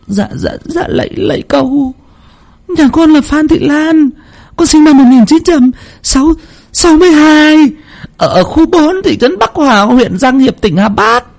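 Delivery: bow and answer the spirit eagerly; eager old woman. Acted. Lan eagerly giving her details to the spirit. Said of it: "mừng hốm".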